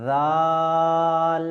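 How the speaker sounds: জাল drawn out very long, tongue between the teeth, no hiss; Arabic letter Dhal. Shown as a demonstration. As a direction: stretch জাল slowly like a Quran teacher. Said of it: "কোনো সিটির আওয়াজ বার হবে না".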